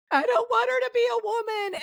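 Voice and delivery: very high, wailing imitation of a crying sister. Retelling her sister's reaction, she imitates her in a high, tearful wail. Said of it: "my sister crying, going".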